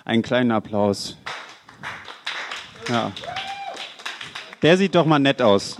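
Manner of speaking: normal talking voice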